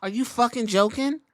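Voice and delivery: usual speaking voice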